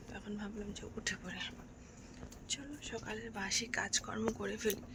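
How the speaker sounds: hushed early-morning whisper so others sleep; whispering on the phone. Real, not acted. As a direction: whisper softly, people are sleeping. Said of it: "সবাই ঘুমোচ্ছে, তার জন্য আস্তে আস্তে কথা বলছি।". (ফিসফিস করে কথা বলা)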